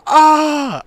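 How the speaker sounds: loud, high "ah" scream; short burst of retold panic; imitated scream. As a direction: scream like a freaked-out kid. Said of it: "He was just like".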